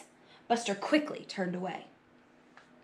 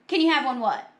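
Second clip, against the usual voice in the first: raised, louder pointed question; 4 semitones higher, 6 dB louder than usual. Acted. Carla making Buster spell it out, loud question. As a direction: ask so everyone could hear. Girl loudly querying whisper.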